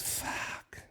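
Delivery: breathy whispered fuck; whispered curse. Real, not acted. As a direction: drop it to a whisper in dismay. Dismayed reaction to Vegas gig.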